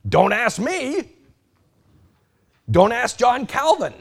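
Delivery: high, loud, emphatic preaching of the quote. Retold or imitated. Preacher voicing the theologian telling his class, high and loud. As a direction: hammer home the teacher's words.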